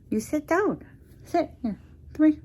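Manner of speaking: high, loud doggy voice; pet talk. Real, not acted. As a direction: coo at the big dog. Talking to her dog.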